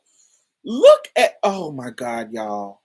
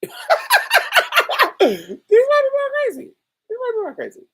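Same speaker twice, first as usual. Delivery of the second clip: high, laughing through the words; laughing repeat; 7 dB louder, faster than usual. Real, not acted. Repeating the joke to her listeners, laughing high. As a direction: let the laugh break over it.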